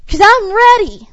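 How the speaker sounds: fed up at the red light; mock exasperated complaint; frustrated impatience. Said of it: "we get frustrated".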